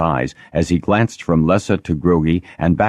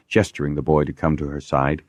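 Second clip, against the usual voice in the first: ordinary delivery, nothing marked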